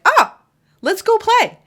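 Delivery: squealed high, eager let's go; playful invite. Acted. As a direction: bounce up high like a kid at play. Voicing playful partner.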